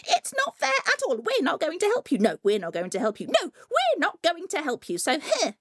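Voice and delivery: fast, high, indignant refusals; squabbling sisters. A narrator's reading. Three sisters refusing Coyote, indignant chorus.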